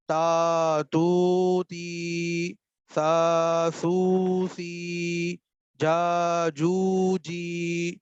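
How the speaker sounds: تا تو تی، سا سو سی، جا جو جی with every vowel held very long and slow. Shown as a demonstration. Teacher drilling long vowels تا تو تی for students. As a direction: drill each syllable stretched out long. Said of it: "یہ جو لمبا کر کے پڑھنا ہے".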